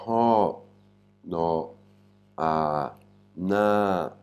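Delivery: "ho, no, a, na", each drawn out separately; sound by sound. Shown as a demonstration. Teacher breaking down a Thai syllable, sounds held separately. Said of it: "comme son".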